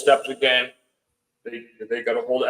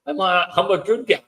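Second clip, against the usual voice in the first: higher-pitched, defensive protest; mild retold protest; 7 semitones higher than usual. Storyteller to the ex-trooper questioning him, mildly protesting. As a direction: protest your innocence in a higher voice.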